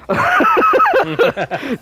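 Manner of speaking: high, loud burst of laughter; sudden high cackle. Real, not acted. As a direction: break out laughing at your own joke. Loud laugh at own joke.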